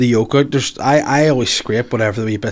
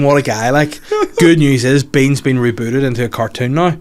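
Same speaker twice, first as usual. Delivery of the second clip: usual speaking voice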